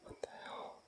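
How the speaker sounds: scared breathless whisper. Real, not acted. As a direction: whisper it, barely audible. Frightened in dark woods.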